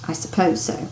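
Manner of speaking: stiff. Acted. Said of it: "said Missus Dursley stiffly".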